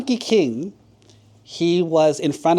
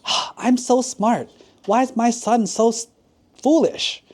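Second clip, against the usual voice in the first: high-pitched, exasperated lament; acted frustrated father, pitched well up; embarrassed, mad advisor; 9 semitones higher than usual. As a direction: whine in mock despair about your son. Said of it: "kind of mad too".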